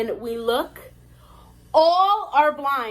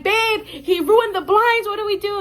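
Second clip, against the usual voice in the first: retold freak-out; 9 semitones higher, 5 dB louder than usual. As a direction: re-enact a panicked call to husband. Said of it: "So I'm freaking out".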